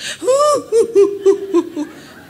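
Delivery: high, loud burst of laughter; hearty laughing outburst. Retold or imitated. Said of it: "Wah, makin kencang".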